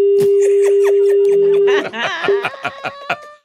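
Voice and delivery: high, loud laugh breaking out; cracking up, unable to hold the act; real burst of laughter. Real, not acted. Prankster breaks character laughing at his own joke, loud high laugh.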